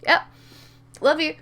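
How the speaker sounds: high, fake-casual greeting; too-casual small talk to cover up; acting natural. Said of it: "Just acting natural".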